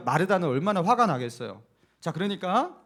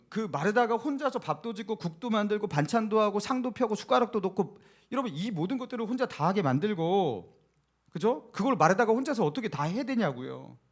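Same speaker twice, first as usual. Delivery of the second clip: ordinary delivery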